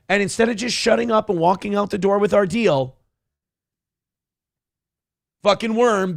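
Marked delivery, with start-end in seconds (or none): none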